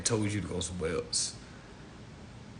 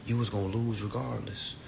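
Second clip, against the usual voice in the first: ordinary talk, nothing marked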